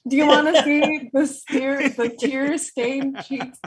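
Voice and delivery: usual speaking voice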